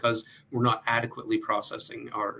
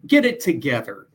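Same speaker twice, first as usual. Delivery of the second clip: loud, raised-pitch self-reproach; scolding self-talk; 5 semitones higher, 8 dB louder than usual. Acted. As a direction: tell yourself off loudly. Voicing harsh self-talk people use.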